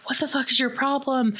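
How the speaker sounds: fast, high mock yell; yells out the window. Trish yelling out window at truck driver, fast high mock yell. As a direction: snap it at the driver beside her. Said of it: "yells out the window".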